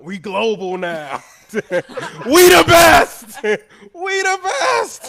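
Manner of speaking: booming catchphrase shout, high and drawn out. Acted. Imagining him at his climax in bed, hammed-up triumphant shouting. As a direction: holler each slogan slowly in triumph, laughing between. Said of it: "can you imagine him when he about to come".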